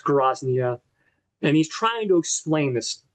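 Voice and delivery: ordinary talk, nothing marked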